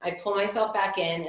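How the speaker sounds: normal talking voice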